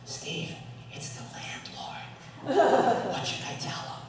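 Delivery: hushed, voiceless whisper so the landlord won't hear. Retold or imitated. Wife whispers landlord is calling.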